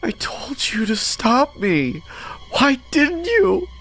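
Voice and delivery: high, slow wailing sob; high-pitched crying accusation; sobbing reproach. A narrator's reading. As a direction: whine it out tearfully, drawn out. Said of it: "I heard the same sobbing sound".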